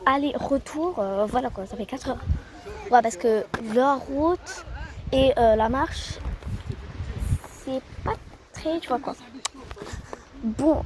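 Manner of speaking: ordinary talk, nothing marked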